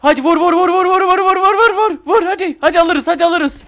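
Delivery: very high, loud rapid-fire egging on; frantic shouted urging. Real, not acted. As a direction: yell them on in the heat of a fight. Urging attack on guard in game. (bağırarak, coşkuyla gaza getirme)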